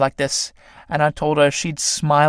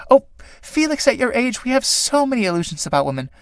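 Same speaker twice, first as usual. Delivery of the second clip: cooing; 9 semitones higher than usual. Acted. Clytie coos at young Felix.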